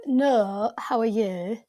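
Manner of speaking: imitated Northern English with long vowels; Northern English accent. Shown as a demonstration. Showing Americans how they'd say it, Northern English accent. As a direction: mimic a Northern English greeting. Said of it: "a Northern English accent".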